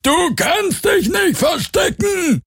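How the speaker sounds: very high, slow drawn-out yell; menacing call stretched across a distance; distant shouted threat. A narrator's reading. Pursuer calls out to hidden Garland, shouting. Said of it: "als irgendwo der Ruf ertönte".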